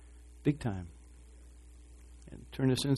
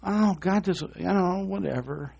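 dismissive tone; 7 semitones higher, slower than usual. Retold or imitated. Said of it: "this whole tone in his heart".

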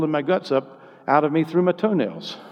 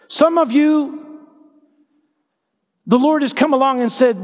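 high-pitched, impassioned preaching; full-force preaching; 9 semitones higher, 4 dB louder than usual. Real, not acted. Preacher promising the congregation hope from the Lord's words, full-force preaching. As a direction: lift the voice and proclaim it.

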